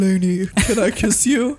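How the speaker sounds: hammed-up seductive lover; deep romantic voice. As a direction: ham up the smoldering ask. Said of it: "I feel like I'm killing it over here".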